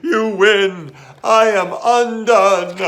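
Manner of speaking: loud, high mock-tragic wail; hammed-up despair, loud and high; blubbering. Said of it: "he blubbered".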